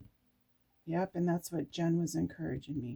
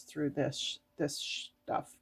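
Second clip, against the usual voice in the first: catching a swear; 3 semitones lower, 6 dB quieter than usual. Real, not acted. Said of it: "I was gonna say a bad word".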